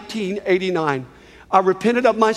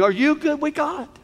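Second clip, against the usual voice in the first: normal talking voice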